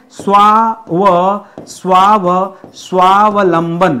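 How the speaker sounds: स्वाव- dragged out very slowly, then स्वावलंबन; slow, drawn-out spelling of स्वावलंबन; syllable by syllable. Shown as a demonstration. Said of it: "स्वावलंबन होता है".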